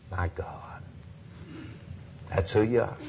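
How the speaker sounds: ordinary delivery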